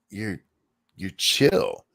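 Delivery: ordinary delivery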